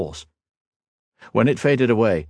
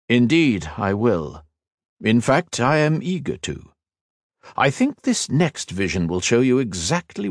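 sneering villain; 3 semitones higher than usual. Acted. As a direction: savour every cold word. Shinzan eager, taunting.

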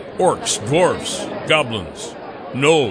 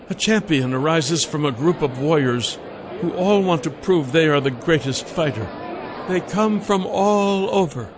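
higher woman's voice; Celine voice; 5 semitones higher than usual. A narrator's reading. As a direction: voice the lady higher. Celine explaining.

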